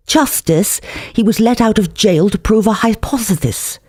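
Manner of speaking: high, precious lady's voice; prim woman. Acted. Woman in pink bow pleading, prim and high-pitched.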